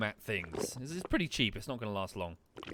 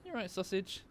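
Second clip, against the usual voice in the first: high pet voice; 9 semitones higher than usual. Real, not acted. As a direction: ask sweetly, pitched up. Checking on the dog.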